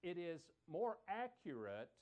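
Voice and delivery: ordinary talk, nothing marked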